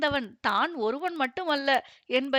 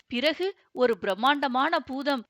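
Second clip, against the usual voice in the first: ordinary delivery, nothing marked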